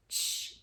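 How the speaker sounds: soft voiceless tch. Shown as a demonstration. Teacher isolating the final sound. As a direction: release a quick tch. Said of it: "que é o tch".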